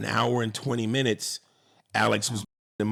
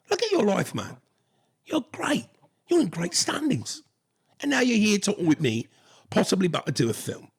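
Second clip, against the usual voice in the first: put-on British accent; 3 semitones higher than usual. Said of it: "in that British accent".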